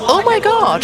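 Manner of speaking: high, startled exclamation; real gasp of surprise; surprised. Real, not acted. Leaderboard shows a surprise leader.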